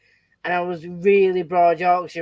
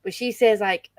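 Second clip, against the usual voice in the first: normal talking voice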